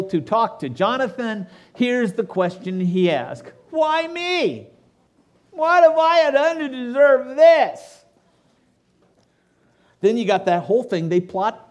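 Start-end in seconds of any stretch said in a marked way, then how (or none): 3.7-8.0 high, loud, slow cry of why me; anguished plea. Acted. David cries out to Jonathan in high anguished plea. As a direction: wail it out like a wronged man begging. Pastor voices David's lament.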